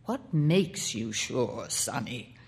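put-on a wary uncle probing the boy. A narrator's reading.

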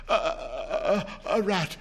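put-on stammering, frightened old man; shaking tones. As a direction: stutter it out in fear. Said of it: "said the old man in shaking tones".

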